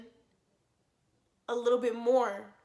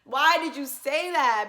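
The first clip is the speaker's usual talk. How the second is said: gushing; 7 dB louder, slower than usual. Retold or imitated. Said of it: "I'm cheesing".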